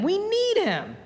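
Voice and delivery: pleading on the word need; lifted emphatic repeat. Real, not acted.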